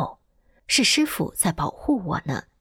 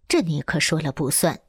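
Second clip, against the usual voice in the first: ordinary talk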